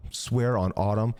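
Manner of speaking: ordinary talk, nothing marked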